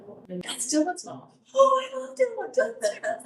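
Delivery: put-on high, sing-out kid voices; playful high-pitched imitation of kids; imitating excited kids. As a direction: mimic children piping up high. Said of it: "they'll be like".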